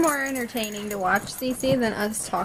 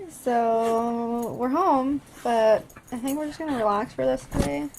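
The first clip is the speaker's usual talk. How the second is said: ordinary talk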